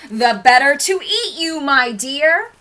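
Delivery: loud, high, rising pounce; wolf's menacing cry. A narrator's reading. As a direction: cry it out big as the disguise drops. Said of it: "cried the wolf".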